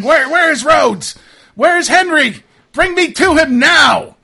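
very high, loud demands; demanding shout. Acted. Game master as Haberson demanding Henry, demanding shout. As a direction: bellow it like an armed confrontation.

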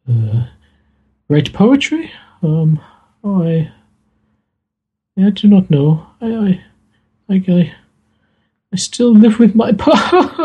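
flustered daughter, sad and shy; voiced Maria. Maria, asked what she does, answering high and flustered.

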